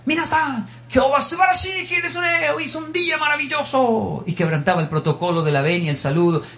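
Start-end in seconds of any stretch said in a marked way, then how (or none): 0.1-4.2 high, bright classroom greeting shouted in Japanese; joyful shouted greeting. Retold or imitated. Teacher bursting into class, greeting students with a high joyful shout. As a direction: burst in calling out to the class with joy. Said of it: "cuando entraba al salón gritaba".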